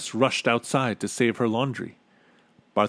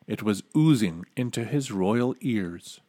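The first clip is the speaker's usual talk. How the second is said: ordinary delivery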